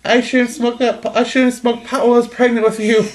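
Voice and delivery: mock horror as a cartoon mother; a hammed-up freaked-out Lois; imitating Lois. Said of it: "And then she goes".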